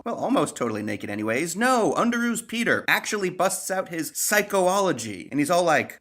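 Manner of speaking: normal talking voice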